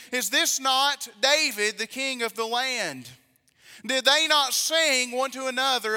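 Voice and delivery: loud, emphatic pulpit reading; scripture reading. Acted. Preacher reads Bible story to congregation, forceful scripture reading. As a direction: read it out strong, stretching key words.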